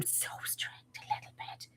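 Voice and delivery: whispered confession; hushed self-mocking whisper; whispered aside. Real, not acted. Joking aside about being strict.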